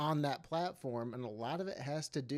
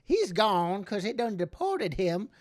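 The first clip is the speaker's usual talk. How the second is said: put-on old-timey Southern old man, building louder; Southern accent; 7 semitones higher, 10 dB louder than usual. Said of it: "do we have to have the Southern accent".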